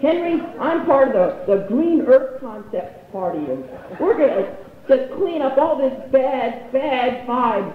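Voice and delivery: high, slow earnest mom impression; hippie mom. Mom telling son of her party, high hippie voice. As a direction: preach it as an airy hippie mom.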